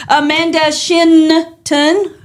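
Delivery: Shinton said slowly, its final N stressed; emphasis on the N. Shown as a demonstration. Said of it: "emphasis on the N".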